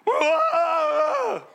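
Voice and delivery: a crowd weeping aloud, mock wail; mock wailing cry. Shown as a demonstration. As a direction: let out a hammed-up wail. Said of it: "Just like that. ... Wasn't that horrible sound?".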